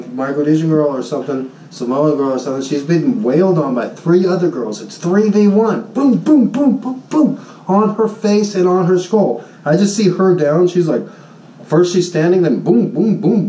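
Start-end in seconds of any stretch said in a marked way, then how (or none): 5.9-7.5 high mock punch noises; punch sounds. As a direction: beat out the blows with your voice. Imitating punches in a fight.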